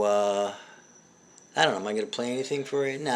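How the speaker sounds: usual speaking voice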